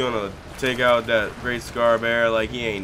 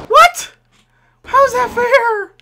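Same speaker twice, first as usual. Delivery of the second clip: squeaking much higher and louder in disbelief; outraged disbelief; 9 dB louder than usual. Real, not acted. Gamer protesting the game's demand, shrill outraged squeal. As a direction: cry out at the unfairness.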